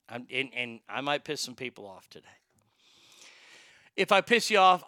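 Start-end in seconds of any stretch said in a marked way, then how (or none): none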